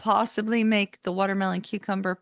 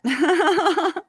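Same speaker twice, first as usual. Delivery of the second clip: laugh; 9 semitones higher, 6 dB louder than usual. Real, not acted. Laughing at own joke.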